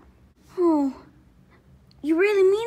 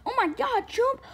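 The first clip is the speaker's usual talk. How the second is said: ordinary talk, nothing marked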